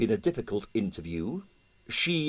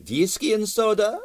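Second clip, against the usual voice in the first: Russian impresario; 12 semitones higher than usual. Acted. Stroganoff voiced by narrator.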